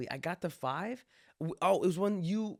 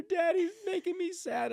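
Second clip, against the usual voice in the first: sad little daughter. Retold or imitated. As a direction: whimper it like a hurt toddler. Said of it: "my daughter be like".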